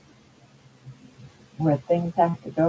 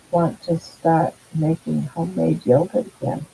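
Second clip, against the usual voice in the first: usual speaking voice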